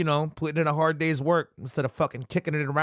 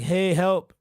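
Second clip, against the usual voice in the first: mock plea for help; 6 semitones higher, 5 dB louder than usual. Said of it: "they're like".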